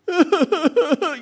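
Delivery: a sudden high, loud laugh; burst of laughter. Real, not acted. Speaker laughing at the thought of hail destroying the garden.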